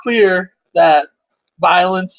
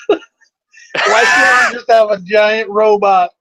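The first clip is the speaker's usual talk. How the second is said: laughing; 2 semitones higher, slower than usual. Real, not acted. Excited wish while laughing.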